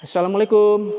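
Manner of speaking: loud greeting echoing in a hollow room; stairwell echo. Real, not acted. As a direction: call out and let the room answer. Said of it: "Menggema suara saya".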